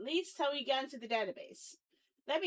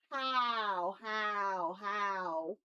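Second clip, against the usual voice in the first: baffled moaning; 6 semitones lower, 4 dB quieter, much slower than usual. Real, not acted. Baffled at own earlier work.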